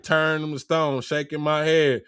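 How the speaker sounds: ordinary delivery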